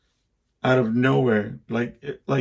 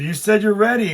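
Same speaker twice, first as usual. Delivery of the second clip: higher, louder mock protest; son protesting; 9 semitones higher, 6 dB louder than usual. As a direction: insist back defensively. Son argues back.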